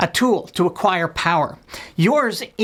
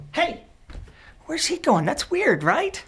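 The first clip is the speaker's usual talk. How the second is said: higher, softer, curious aside; soft, higher, mock-baffled musing; puzzled aside; 7 semitones higher, 4 dB quieter than usual. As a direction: wonder aloud where he went. Puzzled aside mid-appeal.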